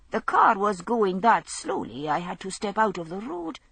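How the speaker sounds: ordinary delivery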